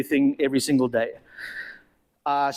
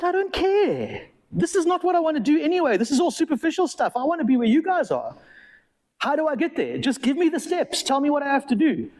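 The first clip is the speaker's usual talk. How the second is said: higher, insistent pleading, begging to be let in; eager pleading; 9 semitones higher than usual. Retold or imitated. Young man begging drug dealers to join them, eager and pleading. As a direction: push hard, desperate to be let in.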